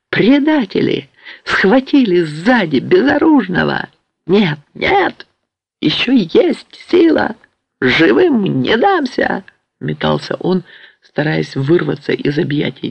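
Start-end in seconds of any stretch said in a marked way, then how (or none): 0.1-9.4 high, drawn-out cries of betrayal; frenzied raging. A narrator's reading. Patient struggles in grandfather's grip, frenzied raging. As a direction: rage in a high voice while fighting capture.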